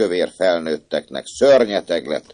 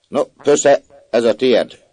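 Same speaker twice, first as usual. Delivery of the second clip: stern mistress; 8 semitones higher, 8 dB louder, slower than usual. A narrator's reading. Mistress scolds the child.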